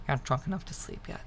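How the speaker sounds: ordinary delivery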